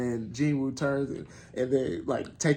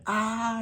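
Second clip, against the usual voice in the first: short high battle yell as he charges; a quick high charging shout; battle cry; 10 semitones higher than usual. Retold or imitated. Hunter charging the orc general, short high battle cry.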